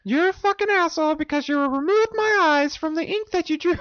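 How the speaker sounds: very high squeaky puppet rant; talking hand. Acted. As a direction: scold in a tiny, high-pitched creature voice. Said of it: "It talks to you like".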